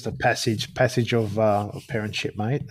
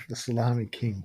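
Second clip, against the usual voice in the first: the salami king, repeated much softer, almost to himself; quiet musing echo; 2 semitones lower, 8 dB quieter than usual. Real, not acted. Guest echoing the host's nickname, softly musing. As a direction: let it fade out as he savours the title. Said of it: "as I said, the salami king".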